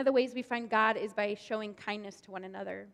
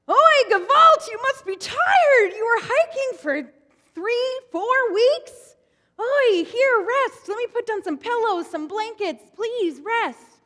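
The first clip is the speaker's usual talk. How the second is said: high, loud, fussing host voice, drawn out; high-pitched welcoming patriarch; kindly old Abraham; 11 semitones higher, 12 dB louder, slower than usual. Acted. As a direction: fuss over a weary guest like a doting old host. Abraham voiced in told story.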